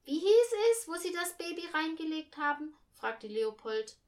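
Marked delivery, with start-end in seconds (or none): 0.0-2.7 put-on high, loud child voice; brash kid's question. A narrator's reading. Boy asks mother about the baby's bed, loud and blunt.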